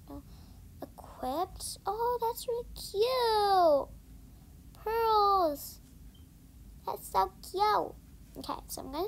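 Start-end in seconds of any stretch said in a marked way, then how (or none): 1.9-7.9 higher, louder, drawn-out "cute"; gushing delight. Real, not acted. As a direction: swoon over the pretty horse.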